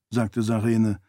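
usual speaking voice

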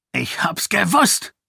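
the king's suspicions confirmed; paranoid outburst. Acted. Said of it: "rief Iadon". (wütender Ausruf)

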